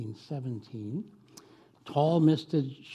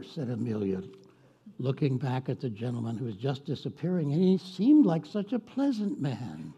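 ordinary delivery

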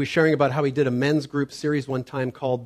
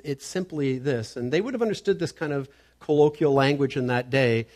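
ordinary delivery

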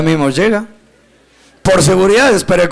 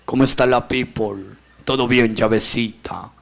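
very low mock street-kid voice; coba street slang; 10 semitones lower, 4 dB quieter than usual. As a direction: greet a buddy in a low, put-on slang voice. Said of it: "los muchachos te hablan el coba". (voz grave imitando a muchacho que habla coba)

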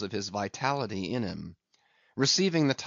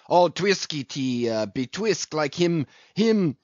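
higher, halting, stuck on the word; faltering for words; 5 semitones higher, 3 dB louder than usual. A narrator's reading. Harpooner struggles to describe twisted irons, faltering. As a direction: stumble along, hunting for the right word. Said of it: "faltering hard for a word".

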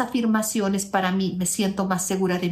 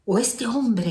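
imitated exasperation. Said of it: "esa persona dice".

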